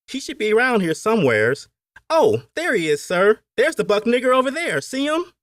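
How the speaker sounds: very high, loud Appalachian overseer; overseer searching. A narrator's reading. Overseer figure.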